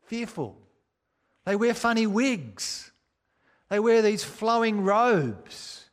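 ordinary talk, nothing marked

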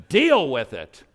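sudden loud snap; exasperated outburst. Retold or imitated. Dad hearing bad news about his boy, loud snap. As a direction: blurt it out like a fed-up dad.

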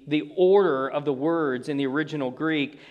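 ordinary talk

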